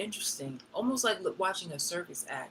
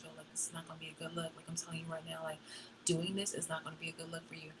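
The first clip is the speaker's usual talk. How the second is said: hushed, much softer warning; quiet aside; 10 dB quieter, faster than usual. Retold or imitated. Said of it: "aside very quietly".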